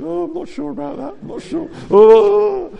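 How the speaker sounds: high-pitched, wavering reluctant voice; mock timid doubt. Preacher mimicking the hesitant majority, high and doubtful. Voicing the hesitant rest of group.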